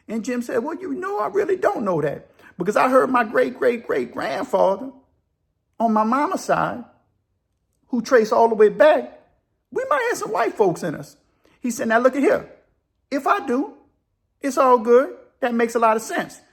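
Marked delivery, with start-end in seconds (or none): 0.6-11.2 high-pitched, animated storyteller's character voice; prison buddy conceding. Retold or imitated. 11.9-16.4 high, emphatic character voice; animated concession. Retold or imitated. Acted buddy accepting the idea.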